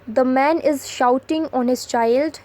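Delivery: very slow, each word set apart; slow dictation read. Shown as a demonstration. Teacher reading an example sentence to students, very slow. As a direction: spell it out slowly for the class.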